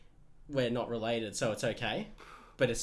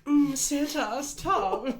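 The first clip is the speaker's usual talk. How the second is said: coy flirty elf; 9 semitones higher, 4 dB louder, much slower than usual. Pretend.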